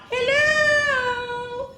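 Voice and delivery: high drawn-out call; long playful hello. Acted. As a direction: call to a far-off alien. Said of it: "let's call out".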